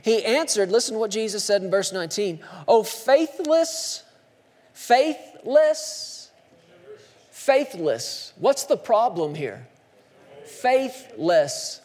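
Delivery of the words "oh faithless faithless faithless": grieving lament. Acted. Jesus lamenting, drawn out.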